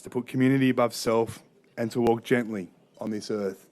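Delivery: normal talking voice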